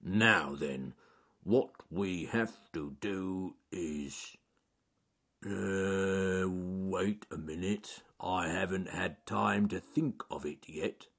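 slow, ponderous bear voice. A narrator's reading. Bear stalling as he has not had time to think, slow and ponderous. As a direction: think aloud in slow, drawn-out words.

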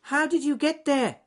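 loud, very high squeaky question; Ernest Fluffy Rabbit. Acted. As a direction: pipe up as an excitable little rabbit. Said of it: "asked Ernest Fluffy Rabbit".

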